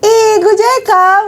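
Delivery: high, lilting Karo speech, gentle; playful imitation of Karo auntie talk; high soft Karo lilt. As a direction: sing it up high but sweet. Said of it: "kayak tinggi tapi yang kayak lemah lembut".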